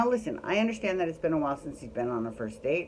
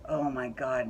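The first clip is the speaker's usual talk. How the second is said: ordinary talk, nothing marked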